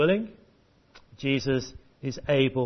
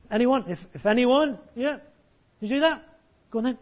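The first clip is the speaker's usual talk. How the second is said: rising higher each time on anyone; prompting the children; 9 semitones higher than usual. Shown as a demonstration. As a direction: coax them to join in.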